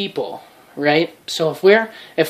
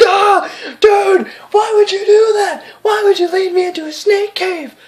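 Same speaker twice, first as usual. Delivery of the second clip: much higher, louder whiny crying wail; mock crying victim; 5 dB louder than usual. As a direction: wail like a friend just bitten by snakes. Snake-bitten friend cries out.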